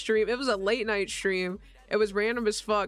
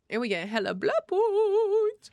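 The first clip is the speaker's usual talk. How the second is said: slow, high drawn-out goth voice; gloomy goth deadpan; 11 semitones higher, slower than usual. Real, not acted. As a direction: drag out the words like a moody goth. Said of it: "now I sound like Goth Boy".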